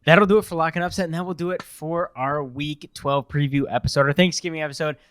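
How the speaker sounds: upbeat host outro; podcast sign-off. Acted. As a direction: wrap up the show brightly. Signs off the podcast episode.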